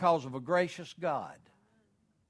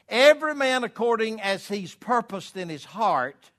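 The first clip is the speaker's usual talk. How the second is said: booming higher, louder verse reading; scripture read with force; 6 semitones higher, 7 dB louder than usual. Acted. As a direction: read it high and loud like preaching from the pulpit. Preacher reads verse aloud.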